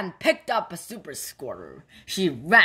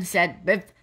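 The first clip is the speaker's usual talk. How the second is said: ordinary delivery, nothing marked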